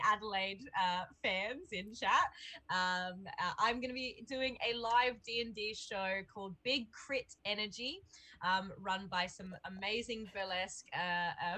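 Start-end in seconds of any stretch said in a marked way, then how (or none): none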